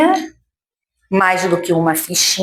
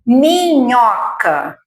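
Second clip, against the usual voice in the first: "Minhoca" drawn out as one falling word; slow, connected model of "Minhoca"; palavra modelo; 2 semitones higher, much slower than usual. Shown as a demonstration. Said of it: "vai formando várias palavras". ("Minhoca" devagar, numa palavra só)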